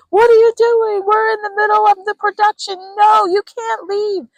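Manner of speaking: high, loud panicked shout; director yelling. Pretend. Director chasing the client across the stage, loud high yelling. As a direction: yell like a frantic director stopping the show. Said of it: "the director comes flying across the stage yelling".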